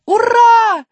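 high loud joyful hooray; cheering. Acted. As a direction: shout hooray. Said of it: "закричала Алиса". (радостное «ура»)

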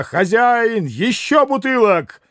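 high-pitched call for service; excited host calling across the room. A narrator's reading. Митя зовёт хозяина за вином.

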